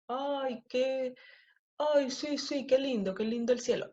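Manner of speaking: high, sing-song mockery of the gushing; mocking sing-song. People mocking her love of the sky, in a high teasing sing-song. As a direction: sneer at her, lifting the pitch in a silly lilt. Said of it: "me han dicho personas".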